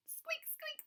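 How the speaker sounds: very high, tiny squeaks; mice squeaking. Acted. Frightened mice squeak all at once, very high. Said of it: "the mice started to all squeak".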